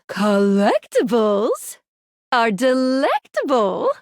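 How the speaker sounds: slow, drawn-out rhyming with swooping pitch; playful rhyme. Acted. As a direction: savour each syllable of the rhyme. Playful rhyme in menu reply.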